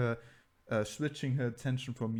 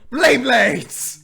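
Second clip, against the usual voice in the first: sudden high, very loud yell; shouted sound effect; 13 semitones higher, 19 dB louder than usual. Acted. Game master mimicking the cloth being yanked, high shout. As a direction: burst out loudly with a mock action cry. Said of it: "it's gonna go".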